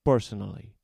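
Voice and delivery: put-on a commanding king with a Spanish accent. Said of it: "Spanish accent".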